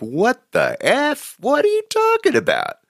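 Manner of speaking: high, far-off mock outrage at the offer; shocked disbelief. To a cab driver offering drugs, a high shocked squeal. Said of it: "I'm like".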